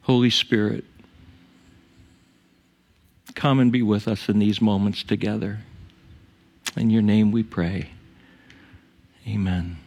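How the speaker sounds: unhurried prayer with long pauses; measured, pause-filled pastoral prayer. Real, not acted. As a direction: pray reverently, leaving silences between phrases. Leads the congregation in prayer.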